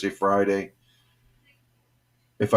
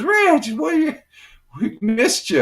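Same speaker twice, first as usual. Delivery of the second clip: excited greeting; 4 dB louder than usual. Retold or imitated. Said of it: "he goes".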